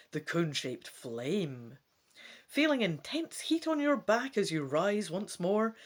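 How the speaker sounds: dramatic gamebook narration; story narration. A narrator's reading. Reading the gamebook to viewers, dramatic narration. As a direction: read it out like an adventure story.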